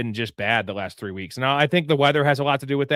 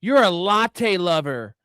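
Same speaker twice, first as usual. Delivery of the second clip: mock slur voice; 5 semitones higher, slower than usual.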